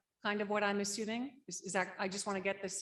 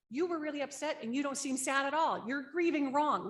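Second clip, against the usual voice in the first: higher, louder, scolding a classmate; mock judgmental scolding; 6 semitones higher, 5 dB louder than usual. Child scolding a classmate for grieving wrong, in a mock judgmental voice. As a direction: accuse them of grieving the wrong way, loudly.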